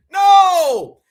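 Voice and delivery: one loud, high, flat refusal. Real, not acted. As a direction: cut them off with a full-voiced NO. Emphatic rejection to audience.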